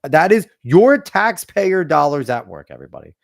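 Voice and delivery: mock-grand sarcasm; sarcastic emphasis. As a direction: drag it out mockingly. Sarcastic call to viewers.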